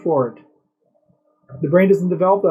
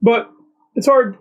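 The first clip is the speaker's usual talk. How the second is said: high, whiny fake-crying voice; mock sob; 7 semitones higher than usual. Mocking the press secretary's crying podcast answer, whiny mock sob. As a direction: ham up her fake tears. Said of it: "starting to audibly sob".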